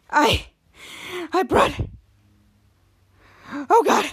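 halting words between heavy breaths; imitated panting. Boy just ran into the store, acted breathless panting. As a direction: gasp out a few broken words. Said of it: "he's panting".

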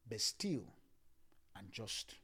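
ordinary delivery

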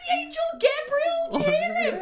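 laughing. Real, not acted. Laughing at angel joke.

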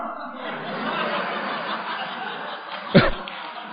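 high, breathy laugh; burst of laughter. Real, not acted. To the meeting, laughing at being called out: breathy laughter. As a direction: laugh at the memory.

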